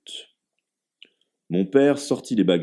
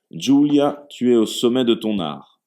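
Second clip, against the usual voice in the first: hearty praise; 4 semitones higher, 6 dB louder than usual. A narrator's reading. Father toasts the cook.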